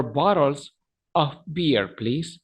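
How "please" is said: ordinary talk, nothing marked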